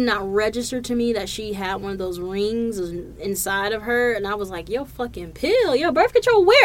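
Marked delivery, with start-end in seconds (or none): 4.7-6.6 mock-panicked questions, climbing higher and louder; retold panic. Retelling the reply to the partner's birth control news, mock panic. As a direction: build into a comic fluster. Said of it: "I was in a panic".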